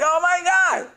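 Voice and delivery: thrilled reaction. Retold or imitated. Son reacting to the record, high giddy laughing delight. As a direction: squeal it out through laughter. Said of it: "And I'm like".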